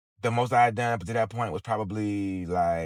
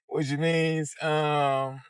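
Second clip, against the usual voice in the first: repeated with a laugh, higher pitch; 4 semitones higher, much slower than usual. Real, not acted. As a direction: laugh through the words.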